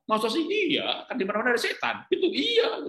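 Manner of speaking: jauh lebih tinggi, seperti anak yang kaget; anak kecil heran. Retold or imitated. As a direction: suara anak tinggi melengking karena heran. Dialog anak dan ayah ditirukan. (anak heran bertanya pada ayahnya)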